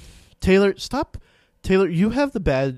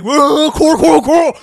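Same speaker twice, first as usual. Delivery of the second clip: high, loud panicked calling; panicked yelling; 13 semitones higher, 14 dB louder, slower than usual. Retold or imitated. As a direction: call out the name in alarm. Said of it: "And he's like".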